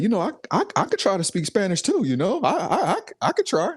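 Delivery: mock the dad eager to join in; imitating the friend's dad.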